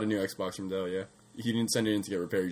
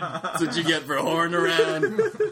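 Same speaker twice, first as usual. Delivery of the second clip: ordinary delivery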